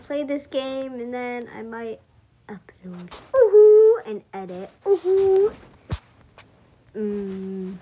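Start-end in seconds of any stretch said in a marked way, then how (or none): 3.3-4.0 loud, high playful whoop; excited whoop. Real, not acted. Playful exclamation cheer. 4.9-5.5 loud, high playful whoop; excited whoop. Real, not acted. Playful exclamation cheer.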